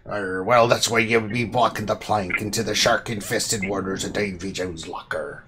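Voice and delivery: hint of a hammy pirate. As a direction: spin it like a storytelling pirate. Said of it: "the pirate voice".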